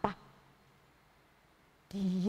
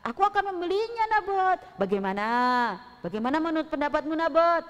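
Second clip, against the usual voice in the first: higher, louder, pushy king voice with a chuckle; a greedy king coaxing the owner; king Ahab pressing Nabot to sell; eager king; 5 semitones higher, 5 dB louder than usual. Acted. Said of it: "kata si Raja Ahab".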